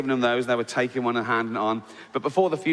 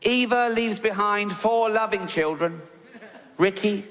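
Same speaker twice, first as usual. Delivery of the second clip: higher, slow pompous vicar reading the eulogy; 4 semitones higher than usual. Retold or imitated. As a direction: play the vicar, solemn and sure of himself. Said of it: "And he goes".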